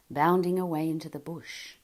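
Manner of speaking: ordinary delivery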